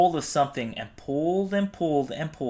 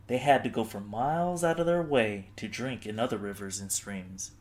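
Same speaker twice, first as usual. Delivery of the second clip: usual speaking voice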